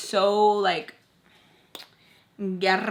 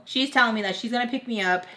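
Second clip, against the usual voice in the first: ordinary delivery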